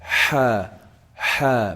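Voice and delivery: ha with sharp mid-throat friction; ha, ha, crisp from the throat; Arabic ha, sharp. Shown as a demonstration. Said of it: "a sharp pronounced letter".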